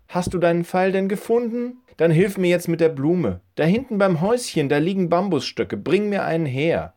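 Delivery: put-on high old lady voice giving orders; an old woman putting the boy to work; bossy old woman. Old woman instructs Joel.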